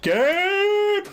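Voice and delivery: high sudden shout; urging shout. Real, not acted. Player urging the scene forward with a high shout. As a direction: call out to get things moving.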